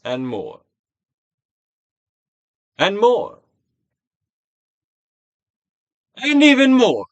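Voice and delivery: from a normal nudge up to a loud high call; building urging. Acted. Urging more release, escalating.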